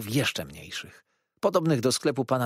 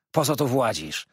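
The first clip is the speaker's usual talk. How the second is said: put-on mildly raised voice of a cross old man; calling out; 2 semitones higher, 4 dB louder than usual. A narrator's reading. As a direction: challenge the intruder. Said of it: "zawołał".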